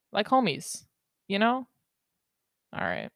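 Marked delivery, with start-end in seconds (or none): none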